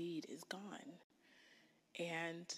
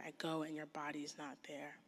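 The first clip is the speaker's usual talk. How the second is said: grieving whisper; 3 semitones lower, 7 dB quieter than usual. Acted. Voicing Mary's grief.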